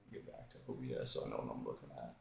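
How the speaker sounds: low, quick mutter to oneself; muttered aside. Real, not acted. Streamer switching back to OBS, muttered aside.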